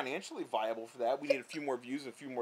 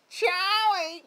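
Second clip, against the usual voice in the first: put-on much higher, loud shrieking call of an old lady; grandma calling Charlie; 9 dB louder than usual. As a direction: holler for Charlie across the yard like grandma. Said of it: "what she actually sounds like".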